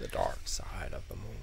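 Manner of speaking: slow, soft movie-line delivery; Transformers reference. Acted. Cohost echoes a Transformers reference, slow movie quote. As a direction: draw it out quietly like a film quote. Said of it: "try to make a Transformers reference".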